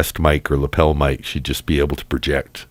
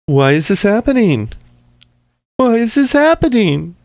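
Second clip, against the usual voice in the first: mock wailing. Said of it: "mocking Haley's cry".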